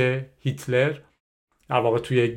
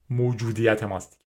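ordinary delivery

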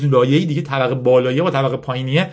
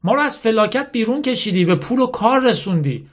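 higher-pitched, lightly acted earnest girl; earnest young Yasmin; 9 semitones higher than usual. Yasmin reasoning with her army's general, lightly voiced earnest girl. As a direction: make a heartfelt case in character. Acts Yasmin pleading with Mala.

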